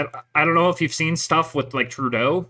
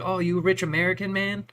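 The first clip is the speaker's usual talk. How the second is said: higher, put-on voice of a movie Dragon Lady; a quick movie-quote Dragon Lady, voiced higher; 6 semitones higher than usual. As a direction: pitch it up as the Vietnam street girl from the film. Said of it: "And she's like".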